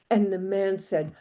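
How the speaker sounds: ordinary delivery, nothing marked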